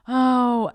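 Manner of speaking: drawn-out mock wail; mimicked pained cry. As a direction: copy a cry of pain, loudly. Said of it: "She's, like, mimicking my noises".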